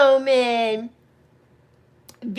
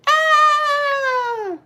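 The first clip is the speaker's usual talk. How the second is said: excited squeal; 14 semitones higher, 4 dB louder than usual. Real, not acted. Live stream, greeting a viewer.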